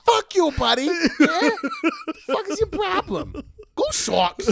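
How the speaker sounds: high, loud, hammed-up Boston tough guy; mock Boston sports fan yelling; aggressive Boston accent. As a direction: bark it like an angry Boston bro. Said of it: "content creator that's from Boston".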